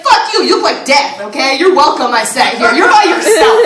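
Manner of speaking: imitated angry comeback with laughter; mock retort. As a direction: snap back at the old lady, half laughing. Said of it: "I wanted to be like".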